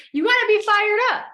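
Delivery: loud, high, pumped-up shout; fired-up yell. Real, not acted. Pumping up her team, fired-up yell. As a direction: yell it to fire them up. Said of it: "like I'm yelling right now".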